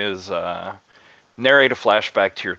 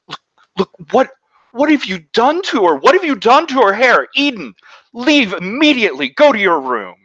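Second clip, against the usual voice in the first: shrill, much higher, loud scolding; angry mother yelling; 7 dB louder than usual. Acted. Mother turns on her son, yelling at him to leave. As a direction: order the boy away in fury. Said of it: "yelling at her".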